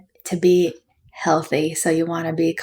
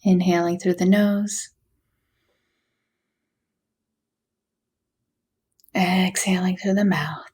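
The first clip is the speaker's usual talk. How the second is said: guided meditation. Acted. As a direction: gently guide them through a body scan, unhurried. Leads a body scan meditation.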